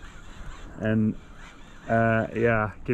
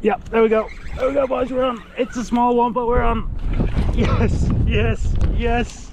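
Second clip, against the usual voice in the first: excited; 9 semitones higher than usual. Real, not acted. Hooking a fish after hours.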